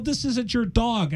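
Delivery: slow, high-pitched retort to his wife; exasperated protest. Retold or imitated. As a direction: re-enact arguing back, stretching the words. Acted quarrel with his wife.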